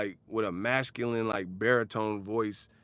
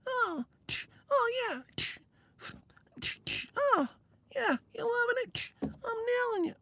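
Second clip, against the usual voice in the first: high falsetto mock moans; squeaky media voice; 4 dB quieter than usual. Pantomiming a media guy in bed, high squeaky falsetto. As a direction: pant out squeaky bedroom boasts.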